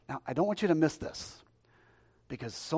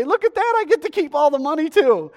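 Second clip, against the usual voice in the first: very high, loud, delighted crowing; hammed-up delight at a windfall; mock glee; 13 semitones higher, 13 dB louder than usual. Mimicking gleeful healed Christians.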